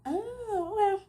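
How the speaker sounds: high, shocked "Oh"; can't believe he left; mock stunned high "oh, okay"; stunned disbelief. Reacting to being left.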